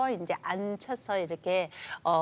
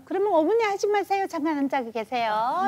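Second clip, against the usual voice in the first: higher, louder, fast call to a senior; loud to elderly; 7 semitones higher, 4 dB louder than usual. Retold or imitated. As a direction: call out loudly to an old mother. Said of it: "이렇게 되죠".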